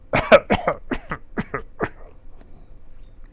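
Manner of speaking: a real raspy cough; demonstrated cough. Shown as a demonstration. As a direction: cough it out hard. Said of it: "Just like that".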